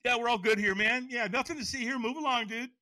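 high, loud, too-quick reassurance; overdone fake okay. Brushing off a worried stranger, overdone fake okay. When we're not okay, what do we do.

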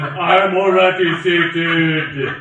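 loud, slow, hammed-up boom; mock boom back. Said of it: "you can boom back".

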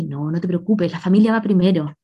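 mock empathetic doctor. Doctor soothing a patient, in a soft fake-empathetic voice. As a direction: put on a fake bedside manner, gently. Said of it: "Tremendo cosplay de mujer empática".

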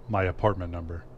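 plain reading voice

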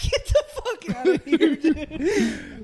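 high, slow, laughing dismissal; laughing disbelief. Retold or imitated. Reacting to the movie scene, high laughing scoff. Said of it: "I'm like".

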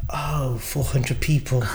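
stunned hush. Retold or imitated. Stunned at event size.

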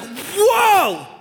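high, drawn-out cry of amazement; awestruck whoa. Acted. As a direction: gasp out a stunned, long "whoa". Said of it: "I'd be like".

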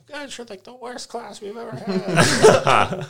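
high, slow laughing teacher voice; mock teacher. Student imitates teachers scolding the class, high and laughing.

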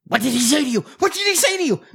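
eager pressing. Retold or imitated. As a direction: press harder each time, voice climbing. Eager psychiatrist pressing Allison.

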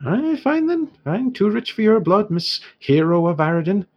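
put-on haggling dwarf merchant in an Irish accent; dwarf shopkeeper in an Irish accent haggling with the heroes. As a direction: bargain in a lilting Irish dwarf voice.

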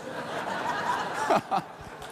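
breathy, quiet chuckle; a short amused laugh under the breath; soft laugh. Real, not acted. Laughs at his own impression.

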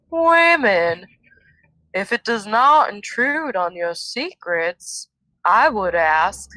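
slow, drawn-out southern accent. Shown as a demonstration. Host reads Egeanin's words aloud in a slow southern accent. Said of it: "she has a southern accent".

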